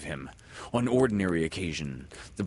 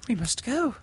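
put-on high, bright girl's voice; almost brightly; 3 dB louder than usual. Windy answering Peter almost brightly. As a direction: chirp it like a tired girl. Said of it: "she said almost brightly".

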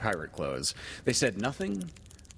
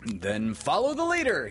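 high, louder merry call; 6 semitones higher, 5 dB louder than usual. A narrator's reading. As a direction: cry it gaily, leading the game. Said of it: "he cried gaily".

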